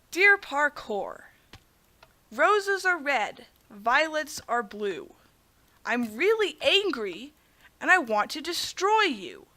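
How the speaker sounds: slow, drawn-out, sing-song verse; mock poem recital. Gamer reciting a hate poem to Parkour, mock solemn. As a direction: intone each line with pauses, mock solemn.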